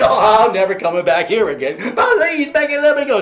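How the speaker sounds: high, loud pleading; loud begging. Acted. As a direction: promise anything to get loose. Devil still begging, laughing through.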